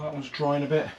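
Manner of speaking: ordinary delivery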